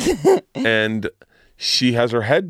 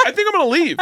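much higher, mock-uneasy voice; mock Leah Remini; faster than usual. Retelling the costar wanting out, high mock unease. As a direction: pipe up like someone ready to bolt. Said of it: "she was like".

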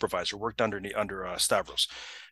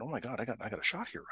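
quieter, private excited thought; inner thought; 9 dB quieter, faster than usual. Retold or imitated. Man thinking to himself he has a shot, quiet inner thought. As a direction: voice a thought under the breath. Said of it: "he's thinking".

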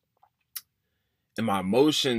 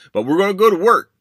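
loud, much higher, pushing himself to go; rally yourself, shouting it out; determined shout; 11 semitones higher, 13 dB louder, faster than usual. Retold or imitated. Pep talk to himself, climax.

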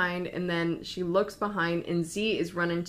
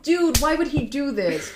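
high, loud retold exasperation; exasperated outburst; 7 semitones higher, 6 dB louder than usual. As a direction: act out an outraged complaint. Z reacts angrily to jump.

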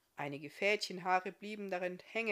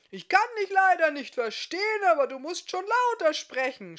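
much higher and louder, a full shout; half-deaf smith shouting at the elf; 11 semitones higher, 13 dB louder than usual. Acted. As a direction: yell it at her as if she were far off. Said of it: "schrie er ihr entgegen".

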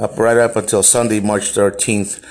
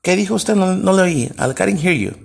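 confrontational challenge; 9 semitones higher than usual. Retold or imitated. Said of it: "I said".

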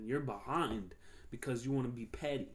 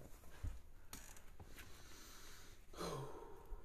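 long sigh; 3 semitones higher, 15 dB quieter than usual. Real, not acted. About to take a dab.

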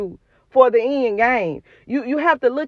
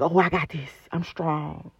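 mock tough self-talk; 7 semitones lower, 8 dB quieter than usual. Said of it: "the enemy ... be like".

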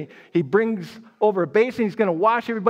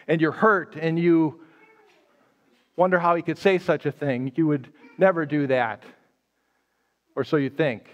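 usual speaking voice

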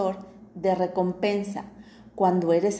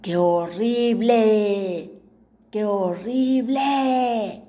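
slow repeated shout like an echo; the cave echoes the boy's shout back; 4 dB louder, much slower than usual. Acted. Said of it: "Y el eco repitió".

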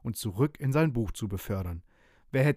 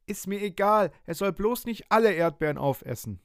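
put-on upset little boy; 8 semitones higher than usual. Boy only wants strawberries saved, protesting high. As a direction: blurt it like a cross small boy. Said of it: "sagt Franz".

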